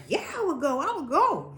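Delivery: a mother jumping at the trip; a hint of an excited mother; eager mom. Mom eagerly agreeing, quoted.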